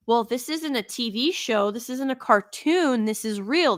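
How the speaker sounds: normal talking voice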